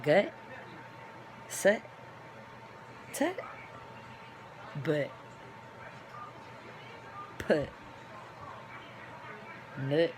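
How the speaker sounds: normal talking voice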